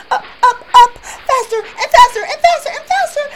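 high, loud, fast and thrilled; rising excitement. A narrator's reading. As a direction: ride the climb to the top. Climbing triumph narrated.

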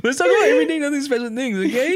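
laughing through the words; mocking while cracking up. Real, not acted.

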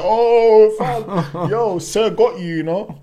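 loud high jeering; hyped teasing crowd. Retold or imitated. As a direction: roar it like mates teasing. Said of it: "his brethren were like".

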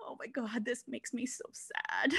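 hushed, slow, mock-tragic whisper; sad whisper. Driver mourning missing cookies, hushed mock whisper. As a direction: whisper it like heartbreak. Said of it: "I'm like".

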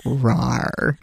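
mock dinosaur roar at talking level; imitating ASMR dinosaur. Retelling an ASMR dinosaur clip, imitated restrained roar. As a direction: do a restrained little monster roar. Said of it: "an A S M R dinosaur or something".

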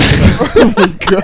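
laughing shock. Real, not acted. Shocked at foot joke.

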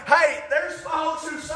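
ordinary talk

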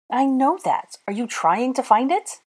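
high-pitched testy retort; exasperated. A narrator's reading. Hermione pressing Draco to search, exasperated. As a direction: argue back in a high voice.

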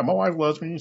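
ordinary talk

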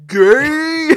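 high, loud drawn-out exclamation; loud imitation of a sitcom outburst; Ken Jeong. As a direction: blurt it out as a gleeful mock shout. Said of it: "Ken Jeong, and he just goes".

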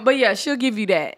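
usual speaking voice

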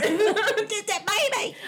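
squealing through a laugh; high, slow laughing squeal. Real, not acted. Laughing at the puppy.

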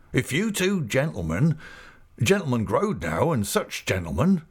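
rustic character voice, lifted in pitch; Mister Peggotty, delighted. Acted. Proud host addressing two gentlemen, pleased rustic voice. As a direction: begin a proud, happy speech in dialect.

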